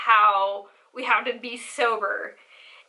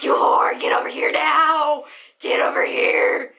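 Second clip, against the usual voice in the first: mock yell at a friend, roughening at the end; retold drunken shout; 3 semitones higher, 4 dB louder than usual. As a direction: holler across the room, hammed up. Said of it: "I remember just shouting at".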